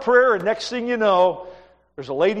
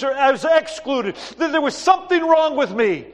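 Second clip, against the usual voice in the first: healed beggar; 9 semitones higher, 5 dB louder than usual. Acted. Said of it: "And he said".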